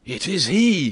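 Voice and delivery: high, loud exclamation; startled, ringing shout; cry of recognition. A narrator's reading. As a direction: cry out on recognizing your man. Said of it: "cried D'Artagnan and the citizen".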